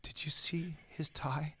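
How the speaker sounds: unvoiced whisper at a funeral. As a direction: whisper it so nobody else hears. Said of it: "He goes".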